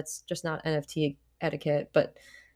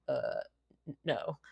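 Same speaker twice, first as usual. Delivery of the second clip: hesitant quiet no; 5 semitones lower, 11 dB quieter than usual. Retold or imitated. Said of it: "And they're like".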